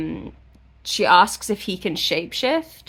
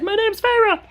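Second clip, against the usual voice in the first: much higher and louder, mock-earnest; high mock Feyre; 5 dB louder than usual. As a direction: pipe it up like a brave little heroine. Feyre quoted giving her name.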